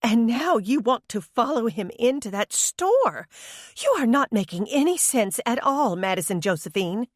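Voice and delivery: high flustered mother voice; sputtering mother. A narrator's reading. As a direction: sputter it out in disbelief.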